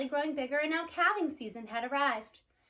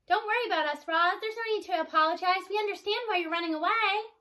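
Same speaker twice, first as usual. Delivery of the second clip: reassuring herd; 6 semitones higher, 5 dB louder than usual. A narrator's reading. Cows reassuring departing robot.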